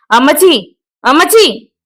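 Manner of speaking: angry son yells for his mother; shouting. A narrator's reading. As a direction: bellow Ammachi across the house. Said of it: "അലർച്ചയാണ്".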